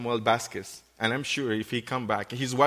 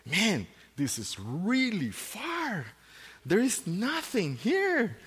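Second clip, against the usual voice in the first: visiting pastor's complaint; 8 semitones higher, slower than usual. Retold or imitated. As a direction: drawl it like an unimpressed visitor. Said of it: "they say".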